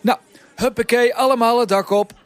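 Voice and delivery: high, loud rallying call; bevelende hoofdpiet. A narrator's reading. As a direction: send the whole crew off, loud and high. Hoofdpiet jaagt de pieten op. (luid, hoog bevel van de hoofdpiet)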